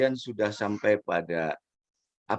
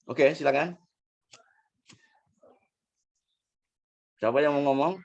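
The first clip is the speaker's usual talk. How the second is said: raised voice calling out; 6 semitones higher, faster than usual. Real, not acted. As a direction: call out to the whole room. Calling on class to speak.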